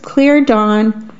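plain reading voice

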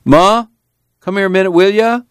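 high-pitched call to another room. A narrator's reading. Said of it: "He called".

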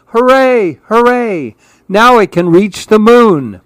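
high, loud hooray; joyful cheer. A narrator's reading. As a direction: cheer up to the others in delight. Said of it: "called to the others".

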